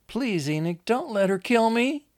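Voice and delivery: high, loud, urgent plea; frightened pleading. Acted. Lizzie begs Enoch to protect her, high and frightened. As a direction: beg him for protection, voice pitched right up.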